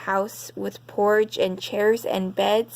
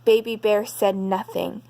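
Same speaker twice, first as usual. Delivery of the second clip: ordinary delivery, nothing marked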